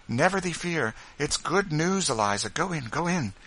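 high, encouraging voice; eager reassurance. A narrator's reading. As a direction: urge her on with good news. Ruth reassures, urging her in.

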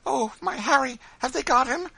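high, loud panicked cry; agonized. A narrator's reading. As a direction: cry out in terror for your child. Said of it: "an expression of agony crossed her face".

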